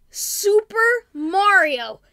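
slow, loud grand introduction: "Super Mario"; announcer voice. Acted. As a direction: present him like a game show host. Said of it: "It's the one and only".

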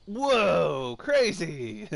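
loud, delighted whoa; impressed exclamation. Retold or imitated. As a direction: gush like a charmed listener. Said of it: "And you'd be like".

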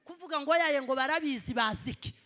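ordinary talk

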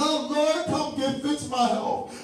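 mock the whiny same old prayer; begging prayer. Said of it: "Begging and begging".

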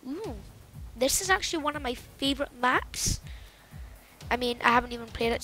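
ordinary talk

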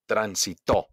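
"transitó" punched on the last TÓ; stress on final syllable. Shown as a demonstration. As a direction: land hard on TÓ in "transitó". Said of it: "hago un golpe de voz". ("transitó" con golpe de voz en TÓ)

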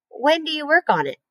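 high-pitched, pleading question; begging. A narrator's reading. Anxious pig pressing the spider about the plan, begging. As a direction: plead for an answer, pitch lifted. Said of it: "begged Wilbur".

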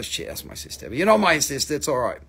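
ordinary delivery

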